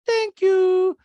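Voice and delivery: high loud caricature of a polite rich woman; Diana voice. Retold or imitated. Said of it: "And Diana goes".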